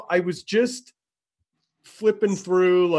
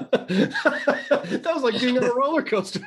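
talking through laughter, higher and slower; real laughter; 6 semitones higher than usual. Real, not acted. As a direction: laugh your way through the words. Laughing after being flipped upside down.

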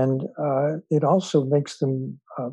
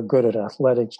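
usual speaking voice

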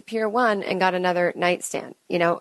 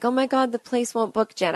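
higher-pitched imitation of worried fans; mock pitying dismay; 6 semitones higher than usual. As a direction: mimic someone fretting on her behalf. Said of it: "this kind of loser attitude".

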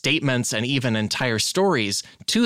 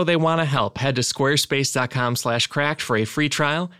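usual speaking voice

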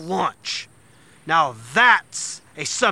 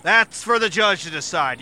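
normal talking voice

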